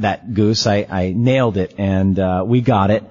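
normal talking voice

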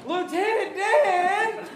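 high, slow, crying-laughing call; Forrest Gump shout. Retold or imitated. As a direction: call out like Forrest across the water. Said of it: "and he's like".

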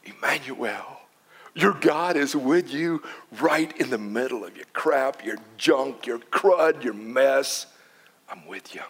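gentle divine comfort; tender God voice. Acted. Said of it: "he goes".

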